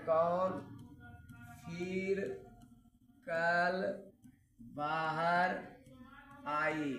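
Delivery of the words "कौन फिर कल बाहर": one word at a time with long pauses; slow mnemonic. Shown as a demonstration. Teacher spacing out a memory trick for students, slowly. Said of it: "trick से याद रखना है".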